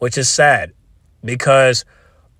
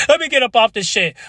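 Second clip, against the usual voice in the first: high burst; 10 semitones higher than usual. Real, not acted.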